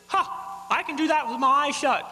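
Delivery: a smug parrot showing off. Acted. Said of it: "he says".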